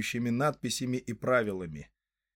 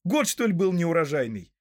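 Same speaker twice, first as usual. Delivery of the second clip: puzzled, higher-pitched question; astonished; 6 semitones higher, 4 dB louder than usual. A narrator's reading. Merry asks about the poor harvest, astonished. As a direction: ask in surprise, starting high and falling. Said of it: "изумился Мерри".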